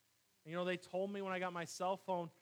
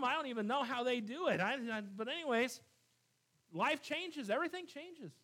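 fiery preaching, louder and higher; 6 semitones higher, 6 dB louder than usual. Acted. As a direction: preach it out with full force. Preaching at full force.

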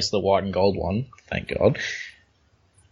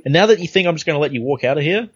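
higher-pitched, mildly hammed-up outrage; comic-book villain's protest; 7 semitones higher than usual. Host voicing a fallen fighter confronting Danny, mock outrage. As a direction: act out a beaten boss's indignation, pitched up. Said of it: "he's like".

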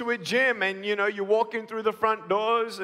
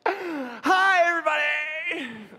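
mock cheerful greeting; 8 semitones higher, slower than usual. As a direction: burst out like an overly happy wake-up. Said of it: "with a smile on my face like".

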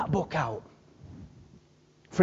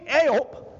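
Yorkshire greeting; 3 semitones higher than usual. Shown as a demonstration. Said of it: "Don't fit, does it".

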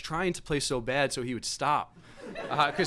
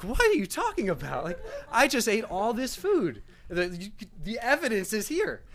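incredulous protest; 6 semitones higher than usual. Retold or imitated. Guest protests to the dad.

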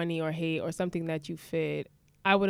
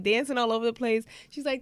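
ordinary delivery

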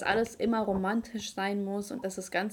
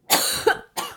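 lautes Husten nach dem Verschlucken; Hustenanfall, hat sich verschluckt; heftiges Husten; 10 dB louder than usual. Real, not acted. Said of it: "Ich hab mich verschluckt". (verschluckt und hustet laut)

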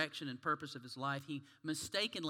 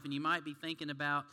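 usual speaking voice

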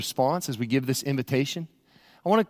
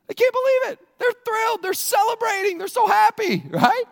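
much higher, bubbling with thrill; excited; 5 dB louder than usual. Real, not acted. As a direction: gush about the celebrating friends. Thrilled crowd described excitedly.

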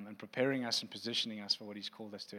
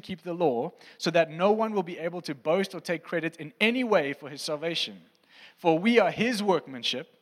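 higher, louder preaching read; scripture reading; 4 semitones higher, 8 dB louder than usual. Acted. Pastor reading Ephesians to congregation, raised preaching voice. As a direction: proclaim the passage to the room. Reads Ephesians aloud to church.

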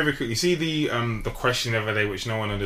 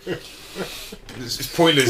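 ordinary talk, nothing marked

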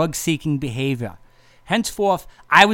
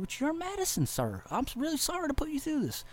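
lightly voiced officer; 11 semitones higher, 8 dB quieter than usual. Officer voice continues.

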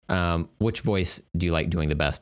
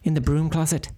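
high fussing nanny voice; Mrs. Doubtfire voice; 7 semitones higher than usual. Acted. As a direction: scold like a prim old nanny. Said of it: "a Mister Missus Doubtfire type of voice".

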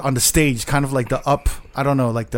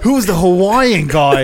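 mock British owner, louder and slower; British accent; 4 semitones higher, 5 dB louder, slower than usual. British club owner questions who the guest is, mock British accent. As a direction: stretch it out in an imitated British accent, amused. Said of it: "You have to do the accent".

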